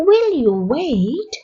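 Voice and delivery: slow question with a stressed "will"; slow repeat, stress on will. Shown as a demonstration. Teacher repeating the question slowly, stressing will. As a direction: lean on "will", then let it rise. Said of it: "repeat slowly".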